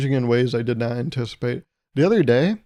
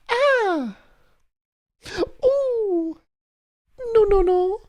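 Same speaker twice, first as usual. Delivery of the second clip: shrieking at cockroach; much slower than usual. Retold or imitated. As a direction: squeal in terror at a roach, much higher. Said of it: "I go".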